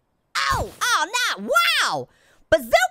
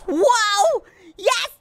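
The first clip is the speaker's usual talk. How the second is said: high, loud one-word yells; cheer, then cry out in dismay; excited outburst; 6 semitones higher, 4 dB louder, much slower than usual. Real, not acted. Player bounces at the blimp, excited yelling.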